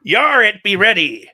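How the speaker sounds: loud, high cartoon pirate. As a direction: shout it like a hammy pirate. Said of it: "talking to it like a pirate".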